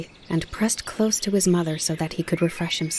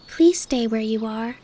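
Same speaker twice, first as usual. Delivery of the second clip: calling softly; 5 semitones higher than usual. Acted. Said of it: "Bambi called to him".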